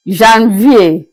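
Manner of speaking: Janvier said zhan-vee-ay; French pronunciation. Shown as a demonstration. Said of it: "pronounced as Janvier".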